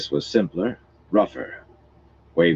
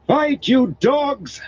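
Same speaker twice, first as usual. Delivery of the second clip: high shrieking cry; 5 dB louder, much slower than usual. A narrator's reading. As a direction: shriek it out, slowly. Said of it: "Anayla shrieked".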